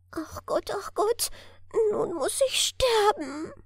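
high, rising wail that climbs with each ach Gott; wailing lament. A narrator's reading. Lost elf alone in dark forest, wailing high in despair. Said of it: "jammerte Immergrün".

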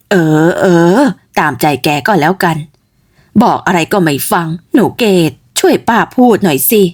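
low, tired giving in; resigned old mother. Acted. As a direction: give up arguing with your stubborn girl. Mother gives in. (แม่ยอมแพ้ลูกอย่างเหนื่อยใจ เสียงต่ำ)

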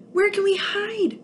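fleeing alien asking where to hide; panicked question. Acted. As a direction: ask in a scared rush.

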